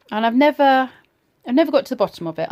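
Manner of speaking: usual speaking voice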